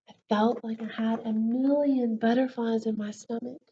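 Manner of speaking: lively storybook voice, big swings of pitch; reading to children. A narrator's reading. Teacher reading a book to her class, lively storybook voice. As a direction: read it to the class like a picture book.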